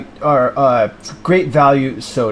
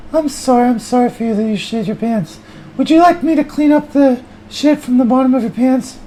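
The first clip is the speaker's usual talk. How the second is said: high, whining loser voice; whiny high character; 8 semitones higher than usual. Acted. High whiny voice bemoaning the crowbar. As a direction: whine it like a hopeless failure.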